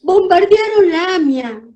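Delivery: high, loud and slow; mother crying out the news of the bombing; anguished cry. Retold or imitated. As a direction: announce the horror, wailing. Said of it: "gritaba mi mamá".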